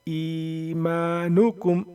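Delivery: slow, drawn-out chanted recitation; Quran recitation. Shown as a demonstration. Teacher reciting the finished word for students, slow chant. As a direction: intone the word like Quran tilawat.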